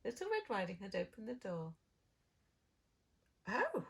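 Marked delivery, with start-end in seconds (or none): 3.5-3.9 high, loud, slow gasp. Acted. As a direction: gasp it in amazement. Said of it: "she gasped".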